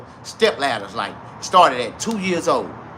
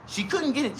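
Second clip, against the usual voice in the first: usual speaking voice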